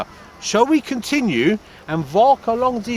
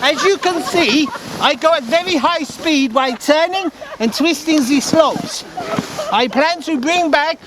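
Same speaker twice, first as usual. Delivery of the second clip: raised voice calling from the slope; 7 semitones higher than usual. Acted. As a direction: call back over the noise of the run. Calling out while sliding downhill.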